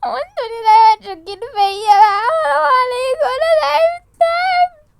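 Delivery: very high, loud sobbing protest; crying out. Acted. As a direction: break down wailing. Layali crying accusation. (صراخ باكي عالي)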